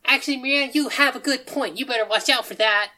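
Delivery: high, lively character voice; cheerful odd character. Acted. Sushi Kid warning an adventurer, high and cheerful.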